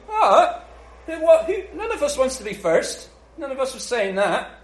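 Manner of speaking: high, loud, laughing bewildered protest; high startled mock protest; flustered protest. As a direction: play the baffled disciples squawking in denial. Disciples voiced protesting confusedly.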